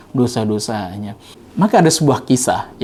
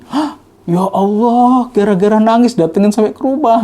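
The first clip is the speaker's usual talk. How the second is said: higher, louder astonished cry; can't believe who's at the door; shocked; 9 semitones higher, 4 dB louder than usual. Retold or imitated. Young man astonished that the Imam came to his home, exclaiming.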